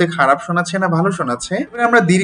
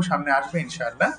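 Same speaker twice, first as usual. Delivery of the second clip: usual speaking voice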